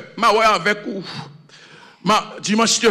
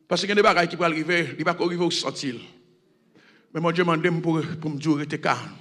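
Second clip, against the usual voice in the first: quiet, low sermon voice; hushed pastoral reassurance; soft preaching; 4 semitones lower, 6 dB quieter, faster than usual. Acted. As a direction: speak gently to the faithful. Soft reflective sermon passage.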